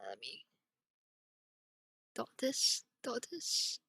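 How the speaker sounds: quiet, breath held while dotting; holding breath. Real, not acted. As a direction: hold your breath and murmur. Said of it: "while I hold my breath".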